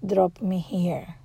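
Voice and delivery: quietly and slowly retold plea; subdued retelling. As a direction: report it softly, drawn out. Retelling sister's words in subdued voice.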